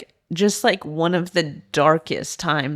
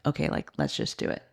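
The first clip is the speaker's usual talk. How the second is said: whispered reply; 9 dB quieter than usual. Retold or imitated. Dad agreeing, retold.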